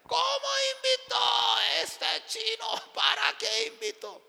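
very high mock angry shout; arguing loudly. Husband arguing with his wife over the guest, angry shouting. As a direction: yell like an outraged husband. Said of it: "otra vez discutía".

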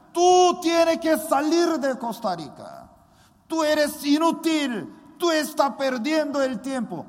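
high, loud accusing voice; voice of Satan. Retold or imitated. Satan whispering doubts to the pastor, loud accusing taunt. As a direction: hammer him with taunting accusations. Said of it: "Satanás ... me decía".

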